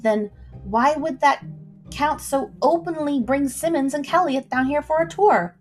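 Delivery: usual speaking voice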